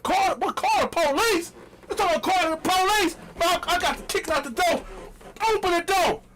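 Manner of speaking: bang on the door shouting; mock outrage. At Megan's door, a hammed-up indignant yell.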